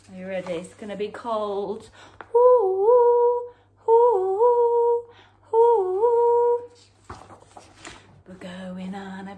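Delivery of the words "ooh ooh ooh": high, loud wailing wind; howling wind. Acted. Reading to children, loud high howling wind. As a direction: howl like a snowstorm.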